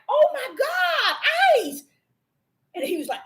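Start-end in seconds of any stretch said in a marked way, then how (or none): 0.1-1.8 high, slow, laughing shock at her own dog; high, laughing cry of shock at her dog; startled gasp. Retold or imitated. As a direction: gasp it out in disbelief. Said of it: "I was like".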